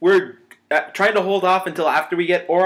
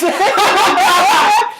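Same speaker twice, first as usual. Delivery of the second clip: high shouted protest; loud outcry; 14 semitones higher, 8 dB louder than usual. Real, not acted. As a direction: yell it out over the laughter. Crying out amid joking laughter.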